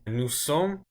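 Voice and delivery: normal talking voice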